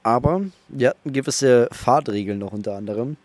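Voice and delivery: ordinary talk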